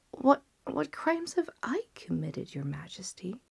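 breathless, whispered disbelief; frightened physician. Acted. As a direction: gasp it out in terror. Physician shocked at sentence.

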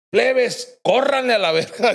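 high, laughing call to run; laughing retold order. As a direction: tell them to run while cracking up. Boss telling the kids to run. (orden de huir dicha entre risas, aguda)

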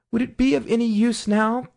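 lightly voiced curious Alice; a girl musing, then slowing; young girl voice. A narrator's reading. Alice thinking aloud.

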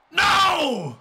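high, drawn-out no; loud wail of disbelief; dismayed shout. Real, not acted. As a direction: cry out as the match slips away. Pin attempt fails in game.

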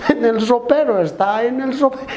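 put-on high whimpering child voice; crying little boy. Little son tells papá where Cuco hides, high mock crying. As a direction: answer in a high mock sob. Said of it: "Su hijo llorando".

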